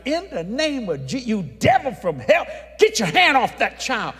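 loud, high preaching rebuke; rebuking the devil. Retold or imitated. Preacher casting devil from sick child, loud rebuke. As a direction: cast the devil out at full force.

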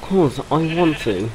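posh voice. Pretend. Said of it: "talking like a posh twat".

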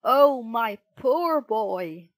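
loud, slow, pitying cry; distressed father's outburst; shocked exclamation. A narrator's reading. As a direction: exclaim with horrified sympathy. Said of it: "he gasped".

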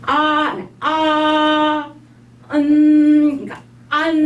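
아 then nasal 음 into 안, high; 안 built from 아 and a nasal end; Chinese an. Shown as a demonstration. Teacher building the Chinese 안 step by step. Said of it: "이거는 그냥 안이에요".

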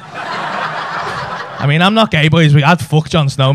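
ordinary talk

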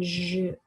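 "zh" as a lone buzzing consonant; isolated zh sound. Shown as a demonstration. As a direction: sound out "zh" by itself, like the middle of usually. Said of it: "making the zh sound".

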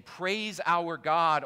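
loud, slow, lifted call to praise; proclaimed praise. A narrator's reading. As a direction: proclaim it slowly from the throne. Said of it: "a voice came from the throne saying".

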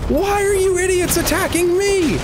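same complaint in a squeaky falsetto; cartoonish high-pitched whine; falsetto shriek. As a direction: shriek it way up high in mock outrage.

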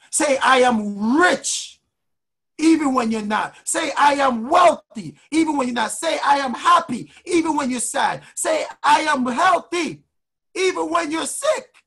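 motivational preaching. Real, not acted. Coach telling listeners what to say of themselves, rising preaching. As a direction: build each affirmation with stretched key words. Leads affirmations, building.

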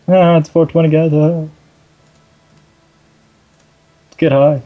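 high, loud goofy cheer; a hammed-up festive shout; mock party cheer. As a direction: whoop it to the chat like a stoner hype man. Joking cheer to viewers.

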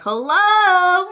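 loud, high parrot imitation; parrot hello. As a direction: squawk it like a parrot greeting. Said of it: "He'll be like".

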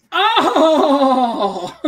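high, loud, drawn-out exclamation; imitated restaurant worker. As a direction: imitate a man's high-pitched delighted cry. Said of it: "this is actually how he sounded".

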